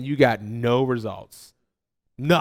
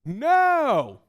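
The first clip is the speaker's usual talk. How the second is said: yelled no; 7 semitones higher than usual. Retold or imitated. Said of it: "Yell back".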